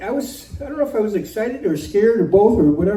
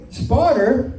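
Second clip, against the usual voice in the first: ordinary delivery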